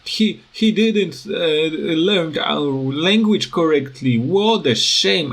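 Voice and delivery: high, scornful mock reaction; mocking locals. Locals scoffing at a foreigner's accent, high mock tone. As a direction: sneer like a snob judging a foreigner's accent. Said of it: "all people are".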